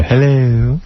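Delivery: drawn-out, put-on British-style hello; affected British-ish accent. Showing actresses' little affect, affected British-ish accent.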